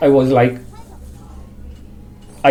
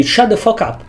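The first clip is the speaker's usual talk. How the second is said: firm command; 8 semitones higher than usual. Retold or imitated. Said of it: "and I say".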